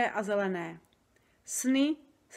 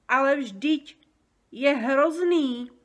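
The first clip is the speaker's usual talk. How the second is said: louder, dragged out in horror; horrified exclamation; 4 semitones higher, 7 dB louder, much slower than usual. A narrator's reading. Sofie recoils from the nightmare, slow loud horrified cry. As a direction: stretch the words in horror. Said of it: "zvolala Sofie".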